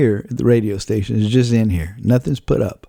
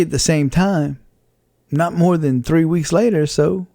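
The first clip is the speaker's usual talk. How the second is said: usual speaking voice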